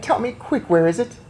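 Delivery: urging grandmother to hurry; eager hurry; impatient urging. A narrator's reading. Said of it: "I, I cried".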